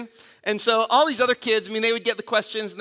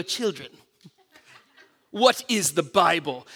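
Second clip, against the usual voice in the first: low, slow quizmaster with a laugh; 5 semitones lower, slower than usual. Retold or imitated. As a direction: play the solemn quiz master. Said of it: "the quiz master would go".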